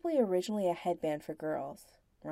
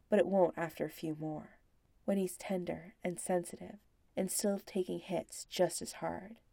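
ordinary delivery

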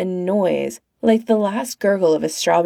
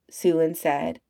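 usual speaking voice

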